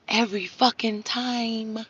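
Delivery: very soft, slow and deliberate; hushed emphasis. Real, not acted. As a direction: drop right down to drive the promise home. Quiet emphatic close of promise.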